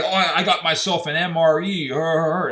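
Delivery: high dopey boast with fake chuckle; mocking imitation ending in a dumb "her, her, her"; mock prepper. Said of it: "and they're like".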